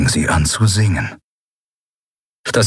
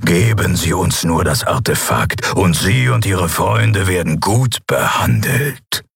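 lower, slow, silky menace; cold emperor; 4 semitones lower, slower than usual. Acted. Shadow Emperor offers the captain a deal, low and slow. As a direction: offer mercy slowly, low.

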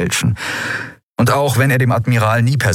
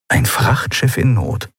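usual speaking voice

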